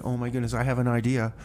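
usual speaking voice

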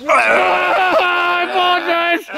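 very high-pitched shrieked apology. Pretend. Apologizing to companion in a high shriek. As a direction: shriek it at the top of your range.

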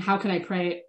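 usual speaking voice

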